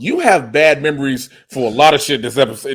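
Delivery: ordinary delivery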